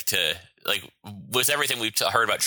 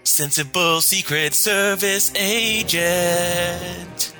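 announcer voice; 10 semitones higher, 4 dB louder, much slower than usual. Acted. Announcing sketch title with music.

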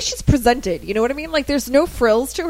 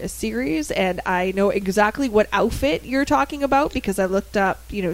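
ordinary talk

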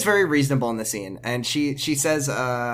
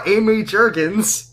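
slow, high mock announcer; hammed-up public address; school PA announcement; 7 semitones higher, 4 dB louder, slower than usual. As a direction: draw the name out like a grand introduction. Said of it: "announcing it to the school".

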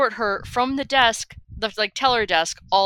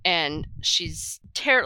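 ordinary delivery

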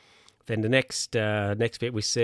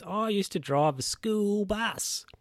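slow, much higher boast from Edgar; high bus driver; 10 semitones higher, slower than usual. Retold or imitated. Edgar boasting to the bowlers, high and slow. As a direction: stretch it out up high, proud of himself. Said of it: "And he goes".

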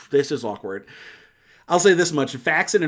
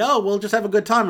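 breezy husband; 8 semitones higher, 3 dB louder than usual. Retold or imitated.

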